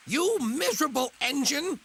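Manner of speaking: sharp high scolding. Acted. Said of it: "she scolded".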